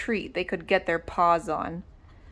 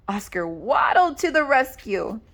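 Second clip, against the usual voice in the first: plain reading voice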